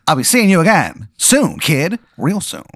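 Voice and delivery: put-on high old-time gumshoe voice; hammed-up detective parting shot; 1920s detective. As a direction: leave with a twenties private-eye warning. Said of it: "he talks like one too".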